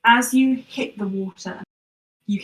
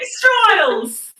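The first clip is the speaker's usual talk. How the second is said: loud, high-pitched mock lament; mock wail; 11 semitones higher, 8 dB louder than usual. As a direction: wail it out in hammed-up despair. Game master reacts to players' panic.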